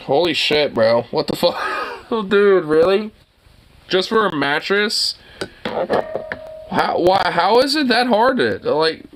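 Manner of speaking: can't believe it's that hard; incredulous. Real, not acted. Streamer reacting to a friend's mattress struggle, incredulous.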